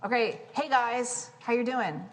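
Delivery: casual greeting. Retold or imitated. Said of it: "I'll just say".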